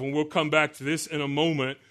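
normal talking voice

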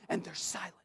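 sudden drop to near-whisper; hushed. Acted. Preacher telling how the angelic hosts fail to answer, hushed. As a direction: let it fall away very softly. Preacher drops voice to show silence.

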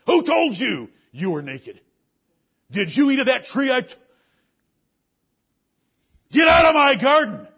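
rising to a loud high shout; furious God. Acted. God driving Adam from the garden, furious shouting. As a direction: build from accusation to banishing rage.

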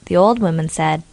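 ordinary delivery

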